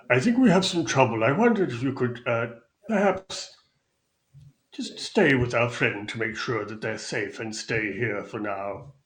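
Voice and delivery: Mister Witch. Acted. Lightly voiced host asking Thako to guard the guest. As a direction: ask it courteously, a bit formal.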